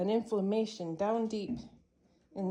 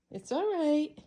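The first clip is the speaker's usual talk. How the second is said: soothing the cat; 8 semitones higher, 5 dB louder than usual. Real, not acted.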